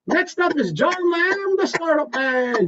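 high, slow announcer call; announcer introduction. Pretend. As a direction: introduce him like a host on stage. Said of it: "It's your turn".

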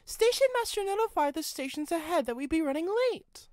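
high, far-off character voice for the guard; guard's matter-of-fact report. A narrator's reading. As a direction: voice the guard lightly apart from the narrator. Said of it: "told the guard".

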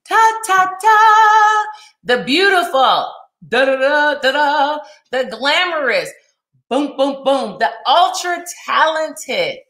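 sung-out fanfare between grand announcements; playful fanfare introduction. Pretend. Host introducing her next person, playful fanfare. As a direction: herald the guest with hammy trumpet noises.

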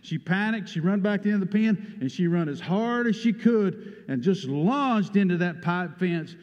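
loud, urgent, rising storytelling; agitated retelling. Real, not acted. Preacher reliving the heifer's crash for the congregation, loud and agitated. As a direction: rush the horror of the crash.

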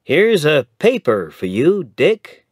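bright rising lawyer voice building to alarm; chatty young man growing surprised; young lawyer, lively. A narrator's reading. Young lawyer hands Dick a paper, lively then startled.

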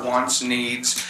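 ordinary talk, nothing marked

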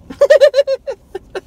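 a loud, high burst of delighted laughter; joyful laugh. Real, not acted. Man overjoyed at his birthday bourbon, laughing loud and high. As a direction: laugh out loud with pure glee.

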